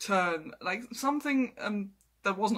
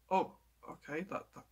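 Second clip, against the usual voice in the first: stunned seriousness; 2 semitones lower, 7 dB quieter, faster than usual. Retold or imitated. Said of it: "serious short moment of".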